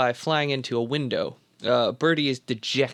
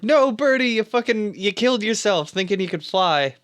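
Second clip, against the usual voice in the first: retold anguished yell, higher and louder; 7 semitones higher, 3 dB louder than usual. Friend shouting after Birdie jumps off roof, anguished yell. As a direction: cry out at the friend who just jumped. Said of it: "Cage is like".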